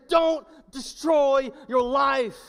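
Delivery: loud, high, slow command; speaking up with authority. Acted. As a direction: drive each word home forcefully. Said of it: "speak up with your authority and say".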